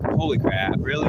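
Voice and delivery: ordinary delivery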